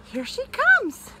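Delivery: high, excited bunny voice; high-pitched childlike excitement; excited little bunny. A narrator's reading. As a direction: pipe up like a hopeful little bunny. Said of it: "He says".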